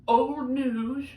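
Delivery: lightly voiced creaky old person; a hint of an elderly voice; quick mock old-timer; old person impression. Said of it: "That's my old person impression".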